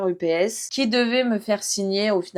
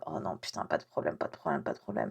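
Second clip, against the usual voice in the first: whispered, much softer, panicky inner voice; panicked whisper; 5 semitones lower, 12 dB quieter than usual. Retold or imitated. Silently panicking while the officer types, whispered. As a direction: whisper your panic so the officer can't hear.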